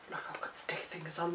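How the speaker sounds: quick whisper to herself; whispered aside. Real, not acted. Crafter notices glue fingerprints on the sheet, whispered aside. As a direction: mutter it fast under your breath.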